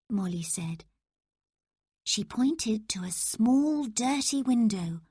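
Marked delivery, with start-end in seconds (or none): none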